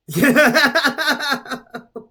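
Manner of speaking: high, loud burst of laughter; laughing. Real, not acted. Reader tickled by a sideways detour, laughing. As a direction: cackle out a long giggle. Amused at going somewhere else.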